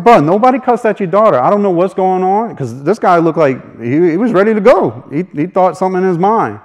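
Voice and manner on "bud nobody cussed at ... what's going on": louder and higher, pushing back; raised, firm denial. Retold or imitated. Worker answering the angry father, firm and raised. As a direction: stand your ground.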